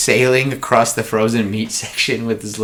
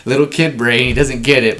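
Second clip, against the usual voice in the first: ordinary delivery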